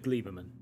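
"Gleiberman" said as GLEE-ber-man; long "ee" opening "Gleiberman"; chosen pronunciation. Shown as a demonstration. Co-host answering which reading they have heard, "Gleiberman" with an "ee" sound. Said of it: "is it Gleiberman or Gleiberman?".

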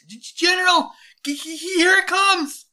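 very high, loud panicked shout; panic-stricken soldier yelling; terrified stammer. Acted. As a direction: stutter out a frantic warning. Soldier sights the diving creature.

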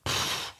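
soft blown-out breath of relief; relieved phew. Acted. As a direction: exhale as if danger has passed. Acted sigh of relief.

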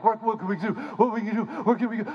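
high, fretful cartoon voice; hammed-up frantic people; frantic crowd. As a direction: babble in fake panic, then settle on Egypt. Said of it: "and they're like".

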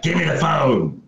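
imitating a hoodie villain's distorted growl; lightly put-on menacing masked-villain voice. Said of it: "like, a distorted voice".

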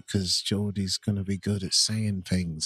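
usual speaking voice

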